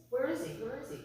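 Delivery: hushed, imitated worried question; acted parent murmuring anxiously; quiet searching. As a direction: ask softly, still holding the worry in. Parents searching, before panic sets in.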